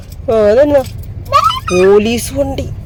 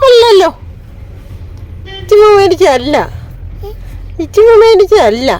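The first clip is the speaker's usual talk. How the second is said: playful teasing; 8 semitones higher than usual. Real, not acted. Mother teases her toddler about who bought the dress, high sing-song.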